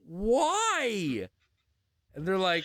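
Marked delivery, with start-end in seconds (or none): 0.1-1.3 high incredulous why; mock baffled cry; shocked why. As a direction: ask it in disbelief. Shocked question.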